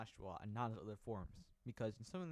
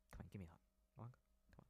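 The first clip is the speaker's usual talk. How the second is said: put-on soothing a child; 4 semitones lower, 18 dB quieter, much faster than usual. Coaxing a hug.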